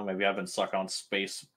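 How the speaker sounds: usual speaking voice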